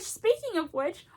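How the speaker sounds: normal talking voice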